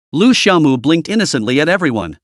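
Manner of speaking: plain reading voice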